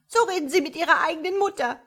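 high, loud, fast wounded protest; indignant outburst. A narrator's reading. Mocked frog mother to the starling, indignant outburst. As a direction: pour out a mother's outrage.

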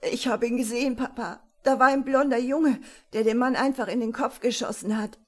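loud, upset insistence; tearful but firm. A narrator's reading. Boy telling his papa of the shooting he saw, tearfully insistent. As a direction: insist urgently through tears. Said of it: "sagte er bestimmt und unter Tränen".

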